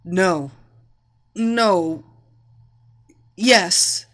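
short, strained single words; choked, reluctant surrender. A narrator's reading. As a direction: force each word out with little air. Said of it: "she choked out".

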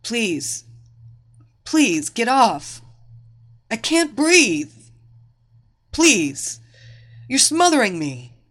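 breathless, desperate plea; struggling to speak. A narrator's reading. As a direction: beg for help while fighting for air. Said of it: "the woman struggled to speak".

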